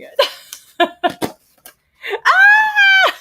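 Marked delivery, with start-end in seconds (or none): 2.1-3.1 high, loud squeal of delight; excited shriek. Real, not acted. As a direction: shriek at the toy. Unboxing the figure.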